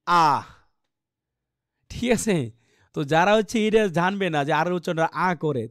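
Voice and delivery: ordinary talk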